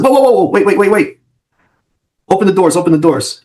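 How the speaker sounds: high urgent calls to stop. Retold or imitated. Officer stopping the ambulance doors, high urgent call. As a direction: shout to halt them, high.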